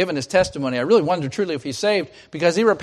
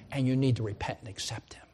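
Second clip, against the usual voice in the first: whispered plea; 5 semitones lower, 9 dB quieter than usual. Acted. Intimate plea to Agrippa.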